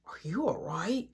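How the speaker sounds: soft retold child's question; hushed, caring question; gentle concern. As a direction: imitate a little brother checking in softly. Little brother gently checking on her.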